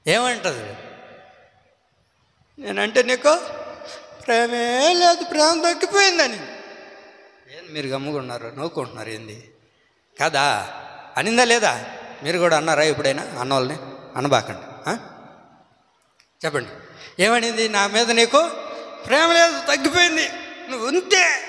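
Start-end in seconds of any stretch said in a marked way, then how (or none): 2.6-6.3 sulking wife. Acted. Delilah sulks at Samson. 17.7-21.4 sulking wife. Acted. Delilah sulks at Samson.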